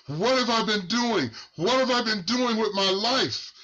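a man waking up to his wasted life; anguished realization. Retold or imitated. Retold man's anguished realization.

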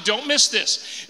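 normal talking voice

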